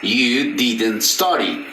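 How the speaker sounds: "I didn't work" said very slowly, each word apart; slow model sentence. Shown as a demonstration. Teacher models "I didn't work" slowly for students. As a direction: spell it out slowly for the class. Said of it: "el verbo va a ir en presente".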